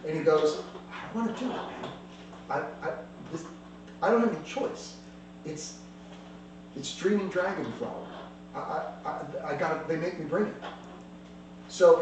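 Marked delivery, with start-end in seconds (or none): 0.9-10.5 teary voice. Acted. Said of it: "his eyes literally start to well up with tears".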